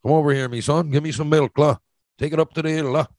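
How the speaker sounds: a hammed-up local Newfoundland sing-song; Newfoundland townie dialect. Shown as a demonstration. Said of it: "Now you come, come in here, you say".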